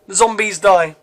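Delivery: plain reading voice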